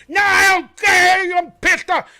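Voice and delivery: high, loud angry yell; hammed-up drunk fan yelling; furious refusal.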